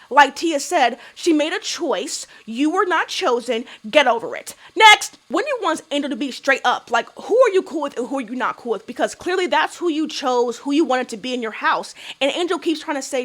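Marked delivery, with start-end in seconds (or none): none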